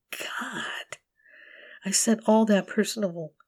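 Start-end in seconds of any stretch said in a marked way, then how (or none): 0.1-1.0 high, whispered sob of a word; crying. Acted. Alone in the dark, a crying whisper to God. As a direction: break down on it.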